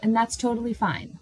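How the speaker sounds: ordinary delivery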